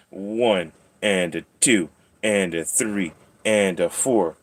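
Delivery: slow, measured counting; singsong count. Pretend. Counting nail clips for the cat, slow singsong. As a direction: count out each beat with falling pitch.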